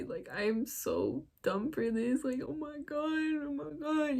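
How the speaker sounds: soft, high tearful whimper; retold crying. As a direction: act out a small pleading cry, voice climbing. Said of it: "crying to him".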